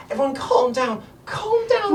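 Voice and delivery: very high little gnome voice begging for calm; a tiny girl hushing the crowd; squeaky gnome girl. Acted. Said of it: "She's like".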